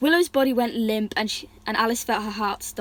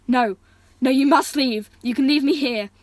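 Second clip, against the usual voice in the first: louder, urgent insistence; insistent; 3 semitones higher, 6 dB louder than usual. Acted. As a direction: firmly send her away. Brother insists she leaves.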